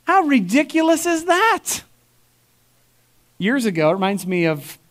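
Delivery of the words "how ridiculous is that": pitched way up in disbelief; incredulous. Real, not acted. Pastor scoffs at the congregation, high incredulous. Pastor mocking sinless claim.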